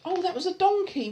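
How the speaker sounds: ordinary delivery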